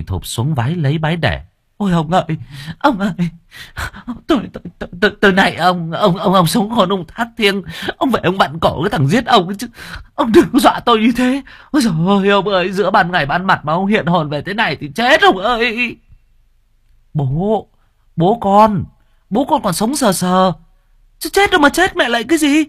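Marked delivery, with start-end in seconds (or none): 1.8-16.0 high, stammering, panicked begging; terrified pleading. Acted. Wife kneels, thinking her husband is a ghost, terrified. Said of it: "Miệng bà run run". 17.1-22.6 high, loud, exasperated shouting; loud son. Acted. Son shouts that his father is alive. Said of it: "Thằng Ất nói to".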